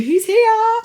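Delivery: friend's text read aloud in a cooing voice; cooing baby greeting. Retold or imitated. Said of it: "texted me a picture saying".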